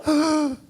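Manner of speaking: acted gasp of panic; startled gasp. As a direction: gasp as if caught out on the phone. She unexpectedly answers, retold.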